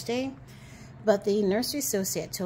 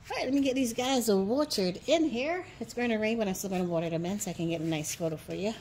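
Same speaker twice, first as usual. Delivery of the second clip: usual speaking voice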